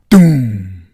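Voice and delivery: loud, drawn-out boom; booming explosion. A narrator's reading. Narrator sounding the gallery explosion, a booming shout. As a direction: bellow the blast, letting it fall away.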